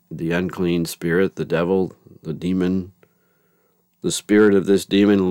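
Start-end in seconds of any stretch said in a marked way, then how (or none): none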